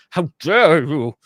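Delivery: loud, drawn-out outraged American; mock American accent. Briton imitates an offended American listener, outraged American accent. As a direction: put on an indignant American accent, slowly. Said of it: "in an American accent".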